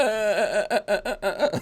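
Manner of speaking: high glitchy robotic stutter. Retold or imitated. Host mimicking Walter's breaking-up connection, robotic stutter. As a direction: imitate a garbled robot voice, stuttering uh's. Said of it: "you're doing a robot voice".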